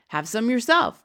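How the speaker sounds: mildly excited call; crying out. A narrator's reading. Giant offering Sophie the bottle, crying out eagerly. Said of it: "cried the B F G".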